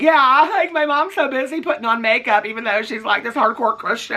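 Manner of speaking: a high mocking impression that misses Lydia. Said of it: "that's not how Lydia talks".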